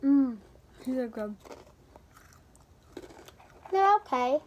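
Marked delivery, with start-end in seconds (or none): none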